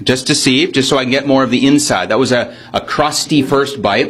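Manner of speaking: normal talking voice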